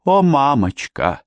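slow, high mock lament; wailing. Vicki throws herself on her mother, wailing theatrically. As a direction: wail it like a theatrical child. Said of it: "запричитала она".

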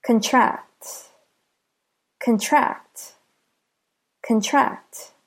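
"Contract" as the verb, con-TRACT; stress on last syllable. Shown as a demonstration. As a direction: say "Contract" with a light first syllable, beat on TRACT. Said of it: "The last syllable is stressed".

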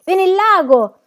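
loud, high warning cry; full-throated shout. A narrator's reading. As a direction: yell the alarm at the top of your voice. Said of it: "Gridarono con quanta voce avevano in gola". (urlo a squarciagola)

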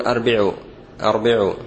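"أربعوا" said arbi'u, a first, i after the b; "أربعوا" with opening a and middle i; mistaken reading. Shown as a demonstration. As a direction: demonstrate the wrong arbi'u for "أربعوا". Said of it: "وهذا خطأ".

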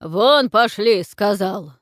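furious bark, slow and loud; рявкнул. A narrator's reading. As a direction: roar them out of the room. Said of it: "рявкнул Тудор". (яростный рык)

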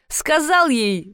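slow, high, amused start; mildly suppressed mirth; choking with laughter. A narrator's reading. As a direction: begin as if holding back giggles. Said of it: "давясь смехом".

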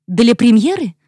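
high, loud startled question; surprised outburst. Acted. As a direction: let it burst out in surprise. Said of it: "вырвалось у Вивьен".

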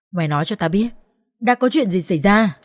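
plain reading voice

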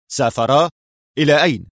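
rising, incredulous question; startled exclamation. A narrator's reading. Director questioning his office manager on the news, startled. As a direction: exclaim in mild disbelief. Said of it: "هتف المدير".

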